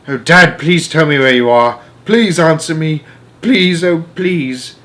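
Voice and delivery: plead, voice close to breaking; boy begging his missing father to answer; trembly pleading. A narrator's reading. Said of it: "my voice began to go all trembly".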